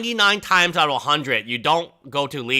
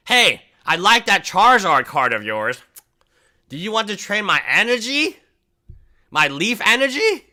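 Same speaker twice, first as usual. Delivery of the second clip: put-on higher, sly kid offering a junk card; cheeky kid; 5 semitones higher than usual. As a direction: sell the bad trade innocently.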